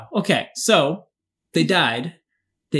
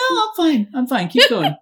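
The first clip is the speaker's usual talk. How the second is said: high defensive; 11 semitones higher, 6 dB louder than usual. Real, not acted.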